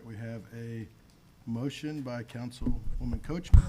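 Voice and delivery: ordinary talk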